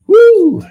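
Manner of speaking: high, loud praise whoop; joyful whoop. Real, not acted. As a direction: let out a jubilant woo.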